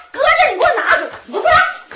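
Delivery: 又高又快地吼出来; 急得嗓门拔高，一口气喊完; angry shout. Acted. Sister-in-law orders him to take mother. (嫂子急眼了，冲小叔子高声快嚷)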